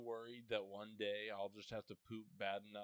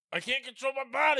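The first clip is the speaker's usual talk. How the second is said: loud mock excuse; 16 dB louder than usual. Hammed-up helpless excuse.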